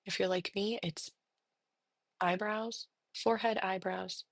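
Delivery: soft, unhurried meditation voice with long pauses; guided meditation. Real, not acted. Therapist leading listeners through breathing and body noticing, soft meditation. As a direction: guide them gently into settling, softly.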